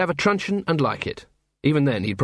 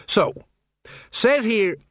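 sing-song; 8 semitones higher, slower than usual. Acted. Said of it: "sing-song voice of someone who learned his public speaking at school".

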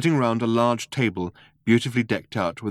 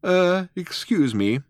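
high, slow, hesitant excuse-me; politely and hesitantly ask for attention; polite interruption; 10 semitones higher, slower than usual. A narrator's reading. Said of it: "coughed politely".

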